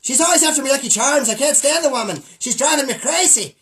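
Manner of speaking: hammy leprechaun rant; bad Irish accent. Host keeps riffing as the mascot, bad Irish accent. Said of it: "It doesn't even sound Irish".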